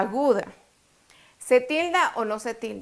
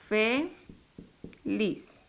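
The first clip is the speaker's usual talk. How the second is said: "Feliz" as "Fe ... liz", softer; syllable by syllable; 6 dB quieter than usual. Shown as a demonstration. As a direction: split "Feliz" with a clear pause. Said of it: "dividámosla". ("Fe ... liz", separado en sílabas)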